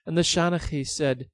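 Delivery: plain reading voice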